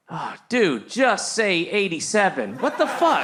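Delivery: rising high mock heckler. A bro at the Gettysburg Address, mocking, rising high. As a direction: scoff like a bro in the crowd. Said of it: "somebody who was like".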